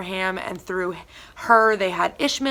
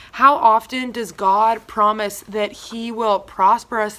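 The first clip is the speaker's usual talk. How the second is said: ordinary talk, nothing marked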